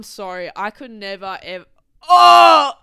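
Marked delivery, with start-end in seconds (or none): 2.1-2.7 sharp, high, loud gasp of revulsion; loud disgusted gasp. Real, not acted. Recoiling from the thought, loud disgusted gasp.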